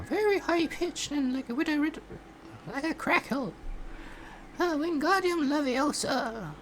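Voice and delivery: very high, crackling witch voice; old witch voice. Shown as a demonstration. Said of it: "if you wanna try sounding like an old, uh, witch".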